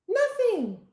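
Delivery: high mock-innocent "Nothing"; son denying. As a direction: play the guilty boy saying nothing. Son voiced in story.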